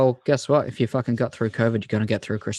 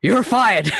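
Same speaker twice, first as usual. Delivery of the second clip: a hammed-up mock Trump, much higher and louder; Trump catchphrase; 11 semitones higher, 8 dB louder than usual. Mimicking Trump firing his rival, hammed-up and loud. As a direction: bark it like a TV boss firing someone. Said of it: "I was just waiting for him to go".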